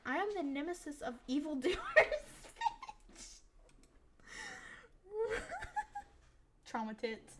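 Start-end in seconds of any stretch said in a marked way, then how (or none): 0.0-3.4 loud, grand self-proclaimed hero; hammed-up hero boast. Heroine declaring herself to the mom, grandiose. 4.2-6.0 high, squealing laughter; helpless laugh. Real, not acted. Player cracking up at the hero line, real laughter.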